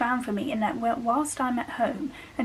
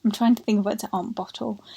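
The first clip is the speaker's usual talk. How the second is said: ordinary talk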